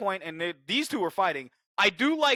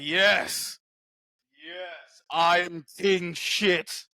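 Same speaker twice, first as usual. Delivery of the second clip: slow smug gloat; much slower than usual. Retold or imitated. Scar King gloating, slow.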